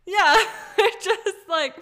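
loud laughter through the words; giggling while agreeing; laughing. Real, not acted. Laughing at the meme.

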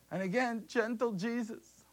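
ordinary delivery

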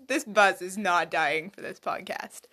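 ordinary delivery

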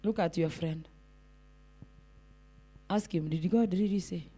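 low, very soft question; quietly feed them the question to ask; hushed prompt. Shown as a demonstration. Prompting congregation to ask their neighbour.

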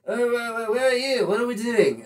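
high, confused pirate voice; lightly voiced confused pirate; dazed pirate. Playing dazed Captain Jack.

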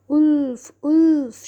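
high, loud alarm call; acted false alarm; shouting. A narrator's reading. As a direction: shout it out with falling, drawn-out cries. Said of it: "shouted the boy again".

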